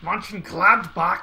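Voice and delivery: loud, forceful German accent; mock German accent. Shown as a demonstration. Streamer trying the club name, loud mock German accent. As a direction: punch it out like a hammed-up German. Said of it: "I gotta say it like a German".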